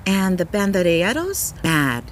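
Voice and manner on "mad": ordinary delivery, nothing marked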